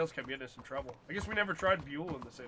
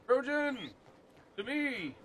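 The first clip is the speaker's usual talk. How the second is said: calling out; 8 semitones higher, slower than usual. Real, not acted. Calling his horse over.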